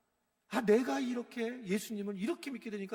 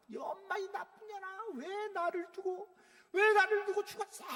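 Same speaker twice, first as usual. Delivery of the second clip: high, anguished cry at mother; retold cursing at mother; 11 semitones higher than usual. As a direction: cry out in grief and rage, much higher. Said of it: "내가 안 하는 욕을 다 하는 거야". (엄마에게 울부짖으며 높게 외치는 욕)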